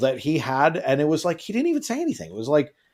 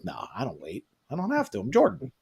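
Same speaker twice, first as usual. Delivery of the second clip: cool brush-off; 3 semitones lower, 8 dB quieter than usual. Retold or imitated. Imitating Jordan's cool swagger.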